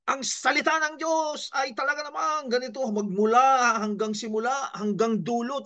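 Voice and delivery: high, booming preacher voice held to the end; preacher at full force. Retold or imitated. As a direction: imitate a preacher who never comes down. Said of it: "talagang yung kanyang decibels, yung taas ng kanyang energy". (ginagayang mangangaral na walang tigil ang lakas at taas)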